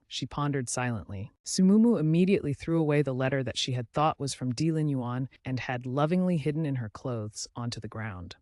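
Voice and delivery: ordinary delivery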